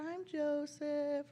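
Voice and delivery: imitated child, high and drawn out; little child. Another costumed child giving a character name, childlike singsong. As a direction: sing out the name like a small child. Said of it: "And they would say".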